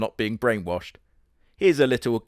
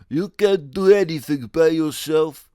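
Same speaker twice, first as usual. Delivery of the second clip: higher, louder put-on voice; Arnold voice; 5 semitones higher, 7 dB louder than usual. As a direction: bark it out as a cartoon tough guy. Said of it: "It's Arnold now".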